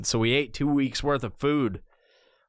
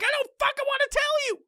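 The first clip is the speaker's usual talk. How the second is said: much higher, fast put-on whine; mock annoyed reply; much faster than usual. Answering everyone who pesters him, in a fast high mock whine. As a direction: squeak it out, fast and petulant. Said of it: "And I'm like".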